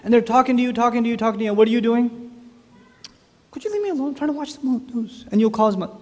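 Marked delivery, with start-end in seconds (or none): 3.5-5.2 high, softer mock pleading; acted weary complaint, pitched up; irritated father. Father wants to watch the news undisturbed, mock irritation.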